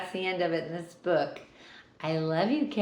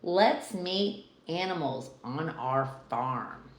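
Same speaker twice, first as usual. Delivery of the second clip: plain reading voice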